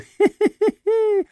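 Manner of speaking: very high silly titter; giggling. A narrator's reading. Maximov titters after betting on the queen, high giggle. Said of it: "Прохихикал Максимов".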